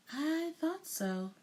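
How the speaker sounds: knowing and kind; with a smile. A narrator's reading. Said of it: "said with a smile".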